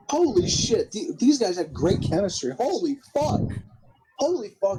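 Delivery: high-pitched, thrilled exclamations; excited amazement. Real, not acted. Host reacts to a great match, excited and amazed. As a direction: gush with your pitch jumping up.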